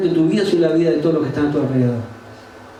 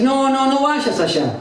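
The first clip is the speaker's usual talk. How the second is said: high, drawn-out naysayer voice; pitched-up, dragged-out discouragement; discouraging naysayer; 5 semitones higher, slower than usual. Acted. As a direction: mimic people talking you out of it, slowly. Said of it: "va a haber gente que te va a decir".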